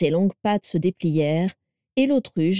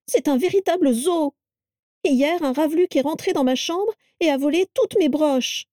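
high-pitched indignant tirade; squealed complaint; 9 semitones higher than usual. A narrator's reading. Carla complains of a creature eating her brooches, squealing. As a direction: rant squeakily, pitched far up. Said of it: "couina Carla".

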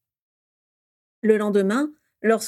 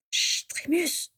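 slow whispered shush, stifling a laugh; slow playful whisper; giggly shush; 8 semitones higher, 5 dB quieter, much slower than usual. A narrator's reading. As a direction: hush him in a whisper, holding back giggles. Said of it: "dit Sirius en pouffant de rire".

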